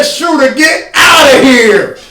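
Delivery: slow, high, loud dismissive shout; hammed-up LeBron telling him to get lost; mock LeBron yell. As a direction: shoo the defender off like LeBron. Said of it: "LeBron literally said".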